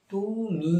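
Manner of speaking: তুমি with the final ই held long and high; full final vowel. Shown as a demonstration. Teacher showing students the fully voiced তুমি. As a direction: stretch the ই of তুমি fully, rising then falling. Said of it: "একটু সুর হচ্ছে".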